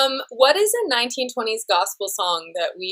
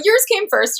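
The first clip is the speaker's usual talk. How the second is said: insistent; 10 semitones higher, 4 dB louder than usual. Real, not acted. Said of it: "repeats insistently".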